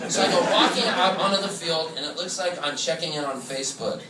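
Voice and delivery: usual speaking voice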